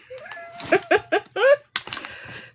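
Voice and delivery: stretched high giggle; high long laugh. Real, not acted. Reader cracking up at the video.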